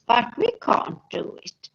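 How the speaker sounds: normal talking voice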